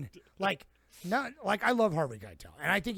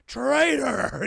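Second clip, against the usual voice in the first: higher, louder hammed-up yell of traitor; mock scream; 7 semitones higher, 8 dB louder than usual. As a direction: bark it out like an angry old man. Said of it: "just starts screaming at him".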